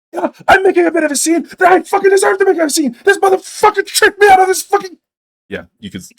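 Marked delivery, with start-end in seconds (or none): 0.1-5.0 high furious rant. Acted. Halfling woman ranting back.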